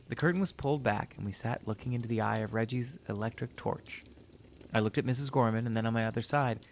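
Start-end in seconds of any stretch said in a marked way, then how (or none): none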